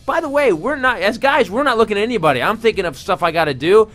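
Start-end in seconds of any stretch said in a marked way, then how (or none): none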